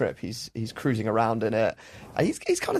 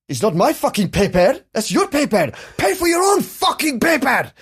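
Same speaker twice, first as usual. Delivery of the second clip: furious dad yelling, pitched way up; angry shout; 12 semitones higher, 4 dB louder than usual. Retold or imitated. Dad yelling at salesman over the paperwork charge, furious shout. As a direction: bellow it at the salesman, outraged.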